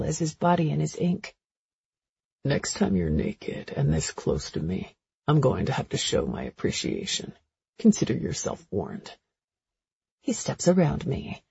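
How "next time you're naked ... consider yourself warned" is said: a flirty man, unhurried and low; deep male voice. A narrator's reading. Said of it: "male love interest voiced by narrator".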